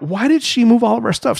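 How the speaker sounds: high-pitched indignant complaint; retold freak-out. Husband griping about the moved beach stuff, retold freak-out. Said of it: "this made me freak out".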